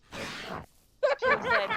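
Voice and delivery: high, slow, breaking into laughter; laughing. Real, not acted. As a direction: say it while cracking up.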